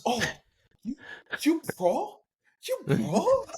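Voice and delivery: put-on very high, astonished Japanese-accented question; mock Japanese accent. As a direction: imitate an awestruck Japanese golfer. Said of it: "Japanese executives".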